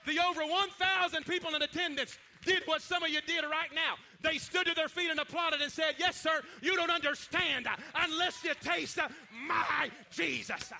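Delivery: loud, shouting preacher building the crowd up; preaching at full force. Acted. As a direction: preach it hard, calling out to the church.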